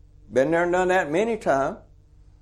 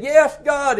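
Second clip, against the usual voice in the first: desperate yes; 9 semitones higher, 3 dB louder than usual. Retold or imitated. Man on the cliff answering God.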